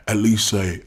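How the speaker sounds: ordinary delivery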